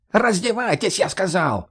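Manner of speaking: high squealed order; shrieking. Acted. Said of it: "перешёл на визг мужик". (переходя на визг)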